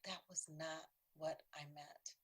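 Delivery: slow, hushed whisper of dismay; aghast whisper. Retold or imitated. Realising you hurt someone, slow shocked whisper. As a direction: breathe it out, stunned at what you did.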